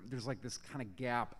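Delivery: normal talking voice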